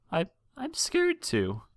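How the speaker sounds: high, trembling voice of a frightened mare; a worried mother holding back tears; tearful mother. Acted. Frightened mother telling the princesses of her missing son, high and tearful.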